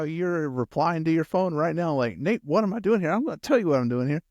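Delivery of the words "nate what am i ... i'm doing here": high, indignant comeback with attitude. Acted. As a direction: fire back, affronted by the question. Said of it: "That's exactly the attitude Elijah had".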